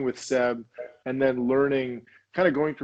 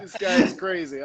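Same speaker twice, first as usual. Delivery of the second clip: laughing quote; 5 semitones higher, 3 dB louder than usual. Real, not acted. Quoting Seb while still laughing.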